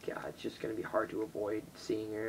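sighing whispered dread. Real, not acted. Dreading meeting his ex tomorrow, whispered with a sigh. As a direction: breathe it out under your breath.

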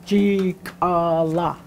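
Chikala slowed right down, chi-ka-la; by syllables. Shown as a demonstration. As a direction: Chikala said slowly, one syllable at a time. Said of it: "separate them by syllables".

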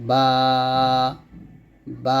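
বা said thin from the lips; light letter demo. Shown as a demonstration. Teacher modelling a thin বা. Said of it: "এরকম।".

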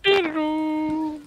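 high-pitched silly call; high squeaky voice. Pretend.

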